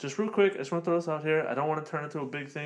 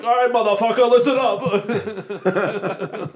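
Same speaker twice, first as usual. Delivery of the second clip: loud, high barking drill sergeant; tough trainer voice; 6 semitones higher, 8 dB louder, much slower than usual. Pretend. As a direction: bark it at the trainees, slowly. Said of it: "I have to use that voice".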